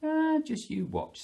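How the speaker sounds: high-pitched cocksure bird voice; Mister Cockerel. Acted. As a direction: boast as a storybook cockerel. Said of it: "said Mister Cockerel".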